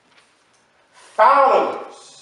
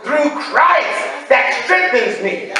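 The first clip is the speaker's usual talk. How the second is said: proclaiming scripture; much slower than usual. Real, not acted. Preacher declaring the verse to the church, slow and shouted. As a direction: drag it out and proclaim every word.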